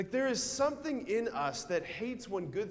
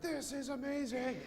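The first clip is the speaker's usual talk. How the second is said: put-on high, thrilled kid gasping at gifts; excited kid; 10 semitones higher than usual. Kid on Christmas morning, excited and high. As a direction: play the kid amazed by presents.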